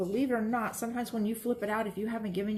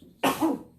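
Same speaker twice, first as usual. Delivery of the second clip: sudden hard cough, louder; a real burst of coughing; 7 semitones higher, 7 dB louder than usual. Real, not acted. Announces a sneeze coming.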